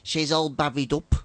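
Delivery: slow, broad Gornal dialect; Gornal accent. Acted. Showing how Gornal people announce a pregnancy, slow Gornal accent. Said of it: "Like in Gornal".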